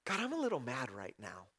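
mock-polite understatement to God; watered-down prayer. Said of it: "we don't have to say".

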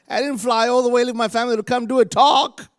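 high, loud protest; indignant. Retold or imitated. Preacher objecting to his sermon being billed a talk, indignant. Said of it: "I'm like".

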